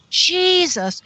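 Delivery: half-real shouted name; retold scream. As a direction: cry it out in release. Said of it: "And I screamed out".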